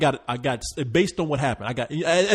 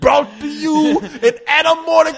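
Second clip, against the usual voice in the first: booming, higher trailer-announcer voice with a fading echo on vision; mock movie announcer; 10 semitones higher, 6 dB louder than usual. Friend plugs his imaginary audio rig, hammed-up announcer. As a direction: hype the fake sound system like a cinema ad.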